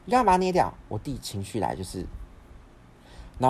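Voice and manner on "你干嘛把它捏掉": high-pitched, loud mother's scolding; retold yell. Mother yelling at son over crushed card, retold yell. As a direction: imitate a mother yelling in alarm and reproach. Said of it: "我妈就大喊说".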